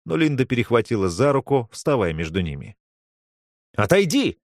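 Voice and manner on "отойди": barked. A narrator's reading. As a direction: bark it out at her. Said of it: "рявкнул Тим".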